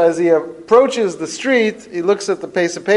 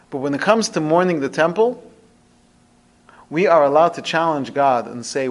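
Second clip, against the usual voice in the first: ordinary talk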